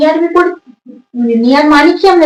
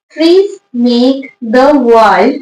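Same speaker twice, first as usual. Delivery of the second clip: very slow dictation; much slower than usual. Shown as a demonstration. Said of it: "teacher reads the question aloud".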